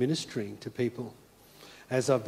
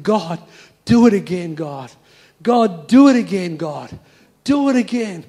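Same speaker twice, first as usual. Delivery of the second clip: crying out to God; 3 semitones higher, 11 dB louder than usual. Retold or imitated. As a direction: beg God aloud as if through tears, retold. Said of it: "And he is crying".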